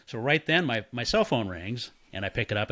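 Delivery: usual speaking voice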